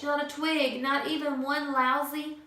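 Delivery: plain reading voice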